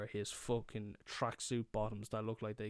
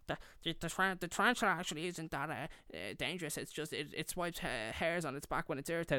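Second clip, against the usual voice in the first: higher, hurried, stumbling nerd correction; nerdy know-it-all; 5 semitones higher, faster than usual. Retold or imitated. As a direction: play a pedantic little nerd tripping over facts. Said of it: "I was such a fucking nerd".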